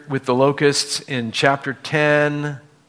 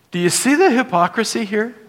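usual speaking voice

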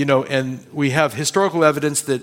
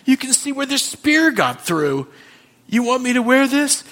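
higher, louder dismayed questioning; shocked protest; 10 semitones higher, 4 dB louder than usual. Retold or imitated. [name] protesting to the Lord, shocked and dismayed. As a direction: recoil at the bloody armor. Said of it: "I go".

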